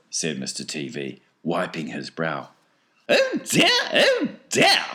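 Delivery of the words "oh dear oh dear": very high, loud falsetto; fretting Wonka. A narrator's reading. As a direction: fret in a piping eccentric voice. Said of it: "said Mister Wonka".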